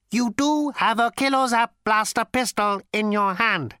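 ancient robot with a cultured rasp; cold, sleek machine voice; cold lifeless robot. A narrator's reading. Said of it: "cold, sleek, and lifeless".

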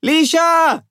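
high, loud shout into the distance; ringing searching yell; calling out. A narrator's reading. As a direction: call for a missing person through a cupped hand. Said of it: "cups her hand to her mouth".

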